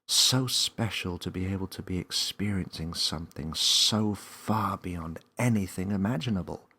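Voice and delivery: ordinary delivery, nothing marked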